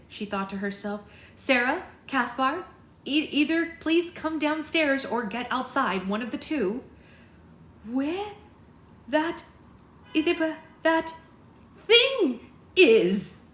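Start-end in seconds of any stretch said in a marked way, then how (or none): 1.4-6.8 higher, louder pleading call; urgent calling out. A narrator's reading. Poppy calling up to endangered couple. 7.9-13.4 high, halting cry, bursting loud; panicked cry. A narrator's reading. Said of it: "cried Sarah".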